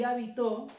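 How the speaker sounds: habitó, stressed on the final ó; stress on the last syllable. Shown as a demonstration. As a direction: push the end of habitó, ha-bi-TÓ. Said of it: "sino le ponemos en la O". (habitó con la fuerza en la última o)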